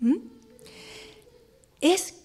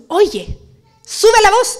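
higher, much louder call of Oye, sube la voz; calling out shout; 5 semitones higher, 10 dB louder, much slower than usual. Pretend. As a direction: shout it to get attention. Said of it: "ella está gritando".

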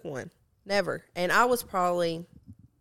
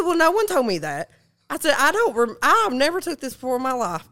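indignant retold protest; 5 semitones higher, 5 dB louder than usual. Retold protest to uncle, freaking out.

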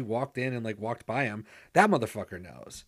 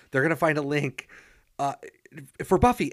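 a mocking line that swells to a loud, higher 'for Buffy'; mocking exclamation; 3 semitones higher, 5 dB louder than usual. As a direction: sarcastically punch out the last words. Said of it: "it's like".

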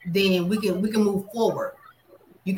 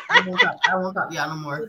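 after laughing hard; faster than usual. Real, not acted. Right after a loud laugh.

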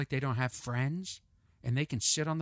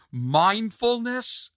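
drawn-out, higher-pitched mock question; mocking disbelief; 6 semitones higher, 3 dB louder, much slower than usual. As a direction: stretch it out in disdain. Mocks the word mindfulness.

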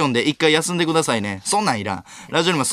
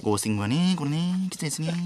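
soft mock streamer voice, trailing away; hushed, teasing impression of a streaming host; Seiya's mimicry; 7 dB quieter than usual. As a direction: imitate someone mocking a streamer, quietly. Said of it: "配信の時の俺をモノマネするせいやの言い方すな".

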